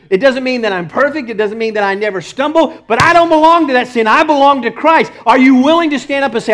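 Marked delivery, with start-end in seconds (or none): 2.9-5.1 high, loud preaching cry; defiant, raised-voice sermon declaration; emphatic declaration. Acted. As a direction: proclaim it with full conviction.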